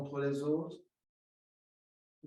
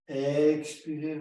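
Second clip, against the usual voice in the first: Expirez stretched slow and louder; drawn-out cue; 7 dB louder, slower than usual. Shown as a demonstration. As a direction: push the exhale out with a long Expirez. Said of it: "cueing a long exhale". (Expirez appuyé et allongé)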